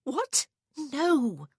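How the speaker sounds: high, alarmed denial; urgent and high-pitched; frantic. A narrator's reading. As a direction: exclaim it in exasperated panic. Frantic woman contradicts him.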